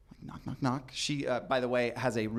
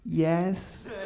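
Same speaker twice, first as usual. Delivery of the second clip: helpless laughter; 10 semitones higher than usual. Real, not acted. Caught in closet.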